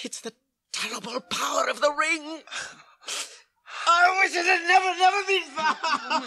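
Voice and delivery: crying through the words, voice breaking; grief-stricken and weeping; tearful anguish. Acted. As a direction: sob out the regret. Frodo weeps over the Ring.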